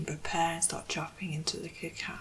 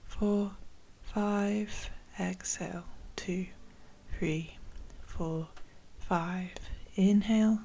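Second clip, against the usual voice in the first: measured, spacious breathing-exercise guidance; guided meditation; 4 dB louder than usual. Acted. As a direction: guide listeners steadily through each breath, with long pauses.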